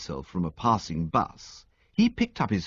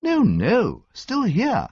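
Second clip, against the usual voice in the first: cheery insistence; 11 semitones higher, 5 dB louder, much slower than usual. A narrator's reading. Bertie cheerfully insists, high.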